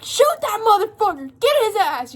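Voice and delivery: high, loud panicked yell; freaking-out boss yelling; frantic shouting. Retold or imitated. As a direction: scream the order at the guards. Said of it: "Drusilla's freaking the fuck out".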